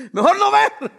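laughing it off. Real, not acted. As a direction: chuckle through the words. Laughing at own fear.

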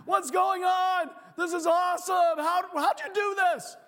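loud, high amazed disbelief; excited disciples. Retold or imitated. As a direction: shout with astonished joy. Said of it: "No, they were like".

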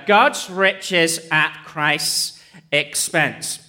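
very slow, pausing between words; spelled-out acronym. Shown as a demonstration. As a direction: lay out each word for the listeners to learn. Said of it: "an acronym".

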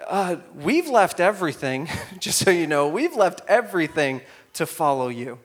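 lightly voiced Peter; voicing Peter.

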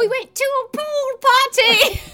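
hammed-up excited shout. Recalling the pool party, hammed-up shout. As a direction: belt it out with mock excitement.

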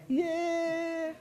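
high, soft, drawn-out cheer; mock cheer. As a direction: feeble high-pitched celebration. Leader fakes joy at joiner.

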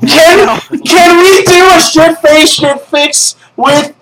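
excited pleading. Real, not acted. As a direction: beg for it with glee. Begging excitedly for a reading.

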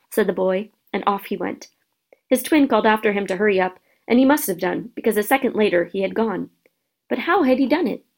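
ordinary delivery

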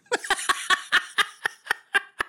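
long, high-pitched laugh. Real, not acted. Podcaster laughing mid-story about the prosecutor, high laugh.

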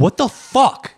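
loud, high outburst; shocked. Real, not acted. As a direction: blurt out in disgust. Disgust at the smell.

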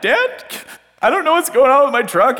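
high, strained plea to dad; upset, high. Retold or imitated. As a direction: mock-desperate high voice.

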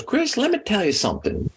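Southern drawl. Retold or imitated. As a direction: lightly imitate a Southern coach's drawl. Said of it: "Southern drawl".

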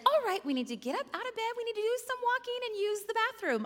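high, brisk, chirpy nurse voice; chipper nurse. Retold or imitated. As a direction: imitate a perky nurse in a lifted voice. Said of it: "The chipper nurse walks in the room".

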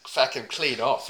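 a hint of a laconic Aussie bloke accent; deadpan Aussie accent, mostly his own voice; put-on Aussie accent. Podcaster riffing about a stranger in a light put-on Aussie accent. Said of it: "I've already got my accent".

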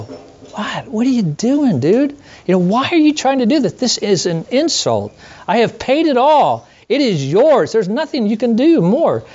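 high, indignant disbelief, fast through the middle; incredulous outburst. Acted. God scoffing at a seven-dollar offer, high incredulous indignation. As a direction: play God baffled by a petty payment.

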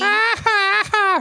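very high psycho cackle; hammed-up, high-pitched mad laughing; crazy laugh. Host mimicking a candidate's laugh, crazy cackle. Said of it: "just gonna do her crazy laugh".

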